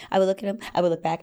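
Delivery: fast, tumbling repetition; rushed. Shown as a demonstration. Mimicking her shy glancing, rushed. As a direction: hurry through the back-and-forth glances.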